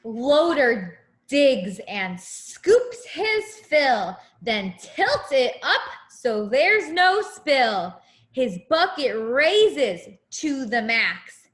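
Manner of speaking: sing-song picture book rhyme; reading to a child. Acted. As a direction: read it like a bedtime rhyme.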